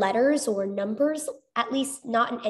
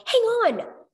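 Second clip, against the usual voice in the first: urgent call; 10 semitones higher than usual. A narrator's reading. She calls to stop Chuck.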